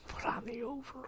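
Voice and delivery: high unvoiced whisper; mock whisper. Cohost echoing the joke to his partner, high mock whisper.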